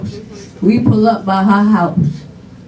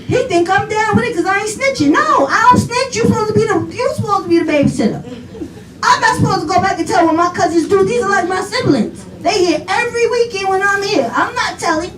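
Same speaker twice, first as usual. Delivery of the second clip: high-pitched acted-out indignation; playful retold refusal; indignant protest; 8 semitones higher than usual. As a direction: argue back like a defiant kid, pitched way up. Child's indignant refusal to snitch.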